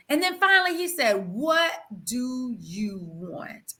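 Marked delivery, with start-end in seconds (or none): 1.3-3.7 slow, low, drawn-out question; slow voice of God. Retold or imitated. God finally asks the weeping wife, slow and low. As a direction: let God ask it patiently.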